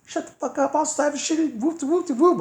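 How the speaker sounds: high, mocking tough-guy voice; imitating the downstairs neighbor mouthing off; high angry neighbor. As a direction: squeak out the threat. Voicing angry light-skinned neighbor.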